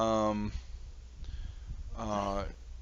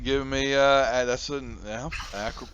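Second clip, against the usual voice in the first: usual speaking voice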